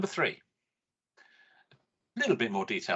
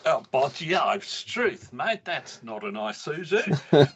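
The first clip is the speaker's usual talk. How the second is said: hammed-up Aussie accent, higher and louder; Australian accent; 4 semitones higher, 4 dB louder than usual. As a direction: play a cheery Aussie bloke in an Australian accent. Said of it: "There goes the Australian audience again".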